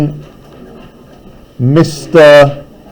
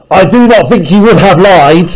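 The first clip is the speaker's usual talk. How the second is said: angry retort; 3 semitones higher, 4 dB louder than usual. Acted. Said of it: "with a flush of anger".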